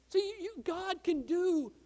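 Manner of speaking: usual speaking voice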